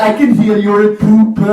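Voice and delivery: loud, high mock complaint; hammed-up Swedish neighbor voice; complaining neighbor. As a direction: play a fussy neighbor protesting loudly. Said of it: "they're like".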